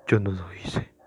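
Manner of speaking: low, soft near-whisper; hushed denial. Acted. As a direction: deny it almost voicelessly, very softly. Suspect softly denies the murder.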